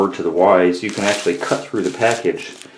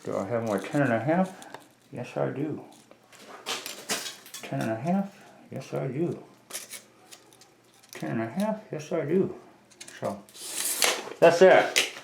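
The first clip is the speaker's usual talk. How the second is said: talking around a pencil held in teeth; pencil in mouth; 6 semitones higher, 7 dB quieter than usual. Real, not acted. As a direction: mumble with a pencil between your lips. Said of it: "had a pencil in my mouth".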